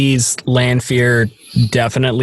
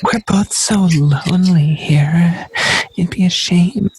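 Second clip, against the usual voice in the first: sexy woman voice. Pretend. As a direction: breathe it out like a temptress. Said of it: "the sexy woman voice".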